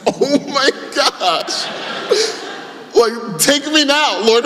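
high, slow, awestruck outburst; overwhelmed dad. Retold or imitated. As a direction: gush like your heart just melted. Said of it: "And I'm like".

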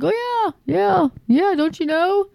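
high, airy mock-cheerful voice; a high, sing-song mock believer; brainwashed believer. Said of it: "finally they're like".

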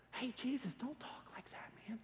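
whispered, urgent plea to a friend; hushed plea. Retold or imitated. Peter privately pleading with Jesus in a whisper. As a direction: pull him aside and whisper it.

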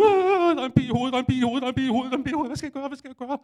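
high-pitched panic. Retold or imitated. Running through the forest with a bee in his head, high panicked babble. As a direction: shriek it out like a bee is in your skull. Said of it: "begyndte jeg rigtig at gå i panik".

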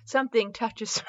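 normal talking voice

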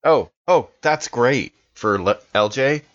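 ordinary talk

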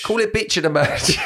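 high, laughing punchline. Real, not acted. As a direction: laugh through the line. Joke while laughing.

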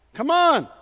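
normal talking voice

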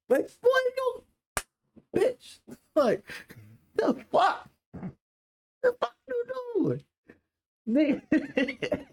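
high, squeaky disbelief; mock outrage. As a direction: squeal it as if scandalized. Outrage at imagined man grabbing him.

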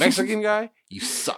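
high-pitched mock verdict; mimicking the co-host. Teasing co-host over his delivery-guy verdict, mocking imitation. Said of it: "but just the way you".

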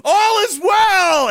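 high, slow, drawn-out frantic cry; Animal House panic guy. Retold or imitated. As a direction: shriek it out as the doomed guy in the stampede. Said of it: "like, in Animal House".

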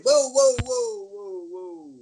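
high, rising string of whoas, fading; alarmed protest. Retold or imitated. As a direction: re-enact putting the brakes on in alarm. Acting out his protest to office.

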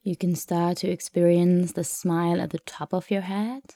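ordinary talk

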